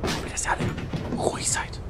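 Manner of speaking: hushed whisper to the viewers. Real, not acted. Whispering to the viewers so they stay quiet. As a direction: breathe it out, no voice, keep them quiet. Hushing viewers before jumpscare.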